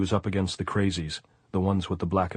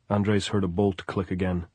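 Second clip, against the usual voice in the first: ordinary delivery, nothing marked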